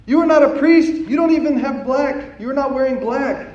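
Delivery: loud, high-pitched accusing shouts; outraged yelling. Retold or imitated. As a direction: imitate an angry crowd yelling at you. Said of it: "like, literal yelling at me".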